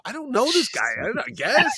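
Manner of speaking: high-pitched, helpless protest; mock exasperation. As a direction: plead ignorance in a comic high voice. Teller squirms under star's questioning.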